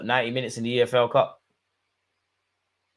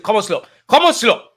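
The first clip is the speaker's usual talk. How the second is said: second take far higher and louder, a yell; urgent shout; 10 semitones higher, 10 dB louder than usual. Real, not acted. Fan yelling at the player from the sofa, loud. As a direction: scream his name at the screen.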